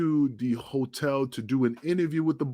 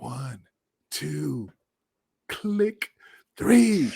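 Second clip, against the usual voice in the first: building countdown; much slower than usual. Retold or imitated. Counting down before kicking door.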